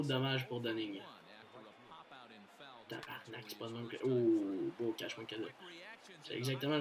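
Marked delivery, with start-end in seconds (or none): 2.9-4.0 whispered curse. Real, not acted. Swearing under his breath.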